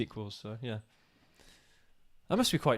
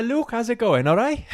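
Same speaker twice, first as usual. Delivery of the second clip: higher, louder mock Welsh accent; attempted Welsh accent; 9 semitones higher, 7 dB louder than usual. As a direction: have a go at Bryn's Welsh accent, pitched high. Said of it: "Think of Bryn".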